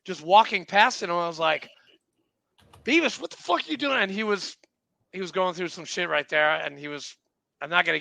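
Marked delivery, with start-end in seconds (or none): 2.8-4.0 high, rushed, incredulous call; retold astonishment. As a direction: blurt it in disbelief, pitched way up. Retold startled greeting to old buddy.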